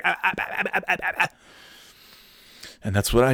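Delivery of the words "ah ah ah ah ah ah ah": tongue-tied stammer. Retold or imitated. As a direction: sputter like a flustered host. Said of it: "They'd be like, tongue-tied".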